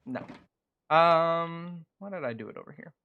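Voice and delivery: ordinary delivery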